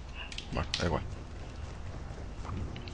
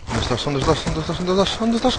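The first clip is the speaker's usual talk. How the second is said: rising frantic calling; 7 semitones higher than usual. Real, not acted. Repeated searching call, rising.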